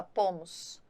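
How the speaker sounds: ordinary delivery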